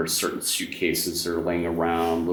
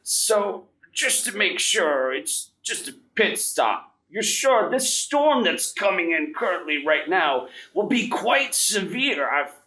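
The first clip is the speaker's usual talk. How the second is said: put-on much higher, slowed, fretting old professor; Professor Dalen; 12 semitones higher than usual. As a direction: fuss over the coming storm like an anxious old don. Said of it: "he begins to chortle yet again".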